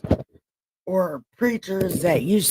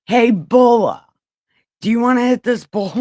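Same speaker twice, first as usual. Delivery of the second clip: loud, fast call; 6 semitones higher, 8 dB louder than usual. Real, not acted. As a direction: call out across the room. Calling out to a viewer.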